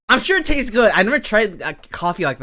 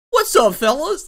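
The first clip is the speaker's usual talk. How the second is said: put-on YouTuber accent; 9 semitones higher, 5 dB louder than usual. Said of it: "look how, like, an accent".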